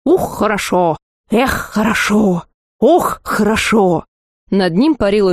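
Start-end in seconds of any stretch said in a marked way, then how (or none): 0.0-4.0 slow, odd-voiced whooping; the monster bouncing on top of the heap, hollering joy; gleeful monster. A narrator's reading. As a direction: whoop each one with glee, drawn out. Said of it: "скакала чудо-юдо и вопила".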